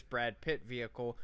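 normal talking voice

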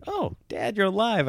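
put-on high, soft kid relief; sweet little-kid surprise; imitating kids. Kids finding dad alive. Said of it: "they're like".

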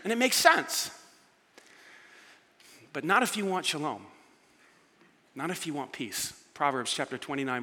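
usual speaking voice

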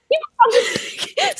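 high, laughing mock instruction; high laughing mock advice to keep the foot propped; laughing high squeal.

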